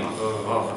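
r, r gargled low and soft in the throat; softly gargle the r, r at the back; gargled French r. Shown as a demonstration. Teacher repeats the gargled French r quietly. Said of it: "something like this".

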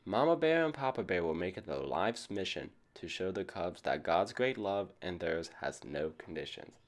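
ordinary delivery, nothing marked

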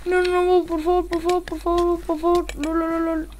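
high, rapid-fire begging; panicky pleading. Real, not acted. Player begging as danger closes in, panicky pleading. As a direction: plead in a high, repetitive stream. Pleading during tense game moment.

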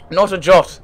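high, loud, cheery reply; cheerful. A narrator's reading. Artificer answers whether he cooks himself, cheerfully. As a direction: chirp it out briskly and cheerfully. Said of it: "the artificer replied cheerfully".

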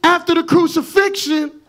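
loud, rising preacher's call; full-force preaching. Acted. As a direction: drive it home to the congregation.

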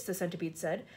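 ordinary delivery

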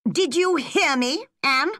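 high-pitched, impatient teacher; strict schoolmaster's challenge; stern demand. Acted. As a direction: press the pupil sharply for an answer.

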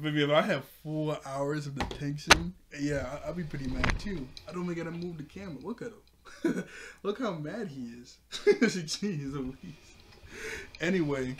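ordinary delivery

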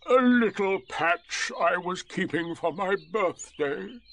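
drawn-out martyred sigh of a voice; Eeyore. A narrator's reading. Donkey giving up his thistles, slow and mournful. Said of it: "he said".

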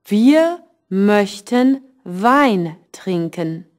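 slow, careful German read for learners; slow teacher demo reading; slow model sentence. Shown as a demonstration. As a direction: spell it out slowly for the class. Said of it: "you can say".

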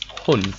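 the final D of Hund voiced as T. Shown as a demonstration. Teacher to class, showing final D sounds as T. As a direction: say Hund ending on a hard T, "Hunt". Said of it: "but pronounced as".

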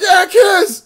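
high, loud egging-on shout; rowdy yell. Retold or imitated. Fans egging the girls on, high loud shout. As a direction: cheer them on like a drunk crowd. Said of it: "And they're like".

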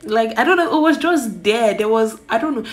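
giddy and flustered. Real, not acted. Flustered about chatting with crush.

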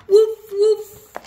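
high, loud yappy puppy barks. Acted. Reader barking as Biscuit the puppy, high and loud.

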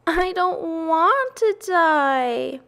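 high, slow, drawn-out wail; tearful moan. A narrator's reading. Pig bursts into tears before his friends, moaning. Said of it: "he moaned".

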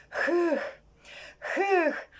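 high, drawn-out weary puff; tired puffing. Acted. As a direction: puff out an exhausted sigh. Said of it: "він дуже втомився і навіть". (втомлене пихкання)

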